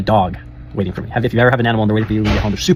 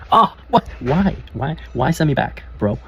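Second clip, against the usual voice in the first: mock shocked protest; 5 semitones higher, slower than usual. Protesting being sent back.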